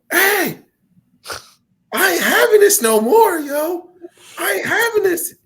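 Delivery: high, loud mock old lady yelling; hammed-up old lady telling her off. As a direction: shriek it like an angry old store clerk. Old lady yelling at gas station.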